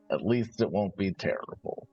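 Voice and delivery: ordinary talk, nothing marked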